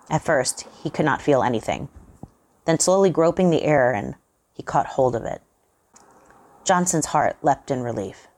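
usual speaking voice

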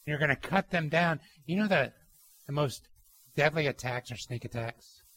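ordinary talk, nothing marked

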